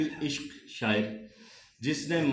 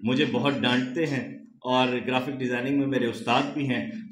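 usual speaking voice

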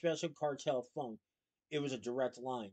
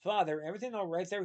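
quick, anxious, higher question; urgent; 4 semitones higher, faster than usual. A narrator's reading. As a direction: rush it out with worry. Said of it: "he asked urgently".

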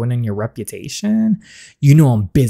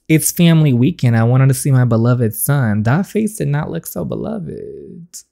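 ordinary talk

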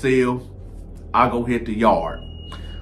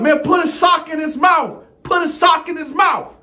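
shouting. Retold or imitated. Inmate yelling from down the tier.

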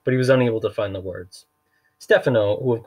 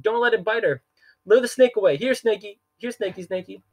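very high, frantic babbling banker; flustered banker babbling in a squeaky panic; panicked babbling; 12 semitones higher than usual. Acted. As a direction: leap about and babble anything at all. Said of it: "talk in such a high-pitched voice".

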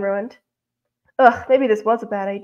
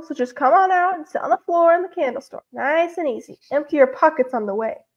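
usual speaking voice

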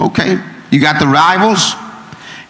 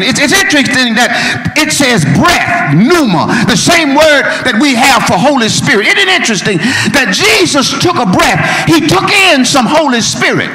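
preaching at full force; 7 semitones higher than usual. Acted. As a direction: lift the church with every line.

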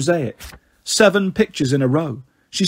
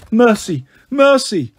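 higher, louder cringing plea, building; begging for mercy; 6 semitones higher, 4 dB louder, slower than usual. Acted. Cowering ghost begs Annabeth for mercy, louder each time.

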